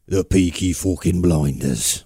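put-on slow, drawn-out Birmingham accent. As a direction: growl it like a Peaky Blinders gangster, slowly. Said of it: "which has got that".

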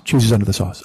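low deadpan correction; dropped-pitch understated reply; super quiet guy. Retold or imitated. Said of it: "his voice is super quiet".

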